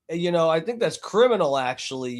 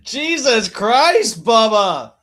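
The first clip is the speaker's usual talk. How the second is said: slow, loud, higher burst of disbelief; exasperated exclaim; 6 semitones higher, 6 dB louder, much slower than usual. Real, not acted. Host reacting to the rant, in loud amused disbelief. Reacting after laughing fit.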